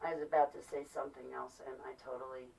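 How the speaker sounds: soft, low admission and plea; subdued aside. Real, not acted. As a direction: drop right down, as if praying to yourself. Loses thread, murmurs a short prayer.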